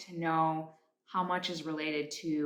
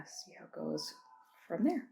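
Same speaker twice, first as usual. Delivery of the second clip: ordinary talk, nothing marked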